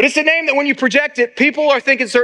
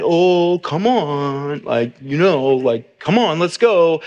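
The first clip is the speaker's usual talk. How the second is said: gentle but firm coaxing, a touch lower; lovingly stern; 6 semitones lower, slower than usual. Retold or imitated. Pastor coaxing his wandering puppy, lovingly stern. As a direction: coax the puppy back with mock sternness. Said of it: "being lovingly stern".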